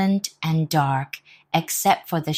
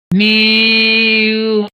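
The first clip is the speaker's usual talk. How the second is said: drawn-out louder cat mew; 5 semitones higher, 10 dB louder than usual. Acted. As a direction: yowl like a cat on the attack. Animal noise attack.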